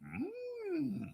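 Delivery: thin eerie falsetto hum; creepy high hum. Retold or imitated. Comic mocking a gruesome story, eerie hum. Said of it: "to which I said".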